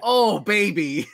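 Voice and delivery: put-on loud, drawn-out "oh baby"; slow, loud cry of delight; excited exclamation. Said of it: "I was like".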